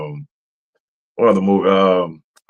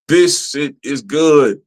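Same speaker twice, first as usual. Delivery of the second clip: slow pleading quote; 3 semitones higher, slower than usual. Retold or imitated. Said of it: "I'm like".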